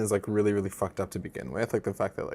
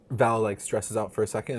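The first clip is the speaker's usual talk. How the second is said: ordinary delivery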